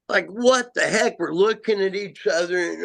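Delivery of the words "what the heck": high, startled question; retold surprise. Asking friends about the strange whoop, startled. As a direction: blurt it out in disbelief. Stunned by the Sasquatch call.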